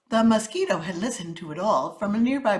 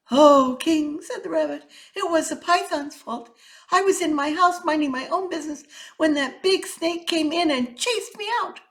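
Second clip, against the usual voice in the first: high, nervous little rabbit voice; timid rabbit; 8 semitones higher, 5 dB louder than usual. Acted. Rabbit excuses himself to the king, high and nervous. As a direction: plead your innocence, higher.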